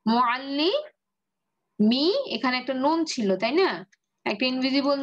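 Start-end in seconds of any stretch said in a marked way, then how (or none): 0.1-2.1 মুআল্লিমি stretched very slowly with a rising end; teacher dragging the word out for the class; drawn out. Shown as a demonstration. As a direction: hold every syllable of মুআল্লিমি as if asking. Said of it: "এটার ভারী ফর্মটা কি ছিল".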